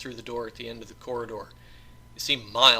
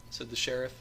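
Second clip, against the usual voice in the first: ordinary delivery, nothing marked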